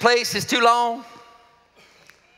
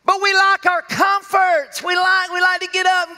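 preached emphasis; 8 semitones higher, 4 dB louder than usual. Real, not acted.

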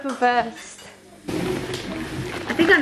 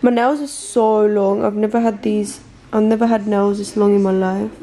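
usual speaking voice

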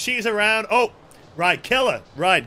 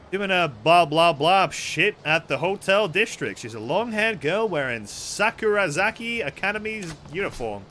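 normal talking voice

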